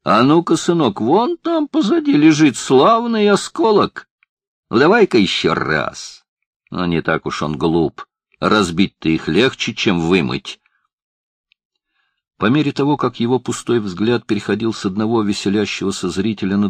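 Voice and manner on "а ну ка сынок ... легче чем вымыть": mocking taunts. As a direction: taunt the kid for laughs. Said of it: "отпускать на его счёт замечания".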